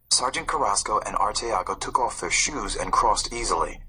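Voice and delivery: usual speaking voice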